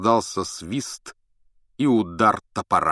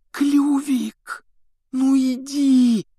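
high, slow urgent plea; desperate hiss; 5 dB louder, much slower than usual. A narrator's reading. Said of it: "отчаянно шипел Гарри".

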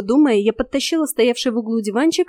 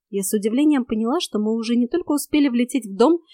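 plain reading voice

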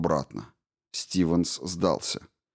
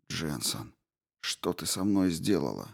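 weak dazed murmur; 3 semitones lower, 7 dB quieter than usual. A narrator's reading. Dazed Stevens accuses Jensen.

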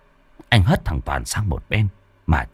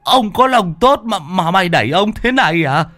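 cursing lame man; 11 semitones higher than usual. Acted. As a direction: swear at the man who shoved you. Pushed-over lame man cursing.

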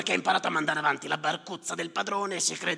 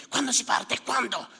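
impatient demand; 10 semitones higher, 4 dB louder than usual. Acted. Passengers pressing Yusuf.

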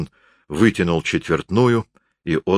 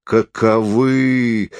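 drawn-out, raised exclamation; indignant cry; 4 semitones higher, much slower than usual. A narrator's reading. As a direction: stretch it out high, appalled. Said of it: "крикнул Карасёв Зойке". (возмущённый протяжный возглас)

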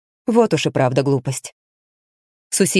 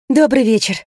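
higher greeting on an exhale; slightly out of breath; 5 semitones higher than usual. A narrator's reading. Rina greets strangers she chased after, slightly breathless. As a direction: breathe it out, a touch winded from hurrying. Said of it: "выдохнула Рина, слегка запыхавшись".

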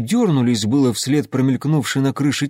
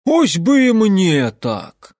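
high, loud, slow wistful exclaim; envious; 7 semitones higher, 6 dB louder, much slower than usual. Acted. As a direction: sigh it out with envy. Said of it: "с откровенной завистью воскликнул".

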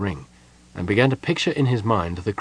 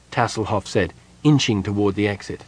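usual speaking voice

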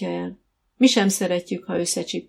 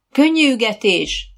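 louder, raised-pitch riding order; called-out command; 5 semitones higher, 6 dB louder than usual. A narrator's reading. Coach to riders changing gait, calling out loudly. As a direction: call the gait out across the arena.

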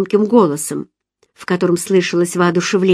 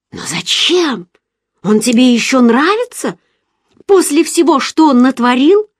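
put-on astonished girlfriend; 6 semitones higher, 3 dB louder than usual. A narrator's reading. Giselle amazed, eyes wide.

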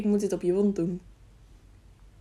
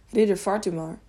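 plain reading voice

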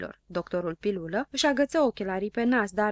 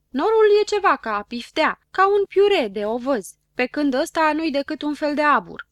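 high, loud contradiction; insistent arguing; 7 semitones higher, 8 dB louder than usual. A narrator's reading. Habar n-am disputes Știe Tot about clouds, insistent. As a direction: insist you know better.

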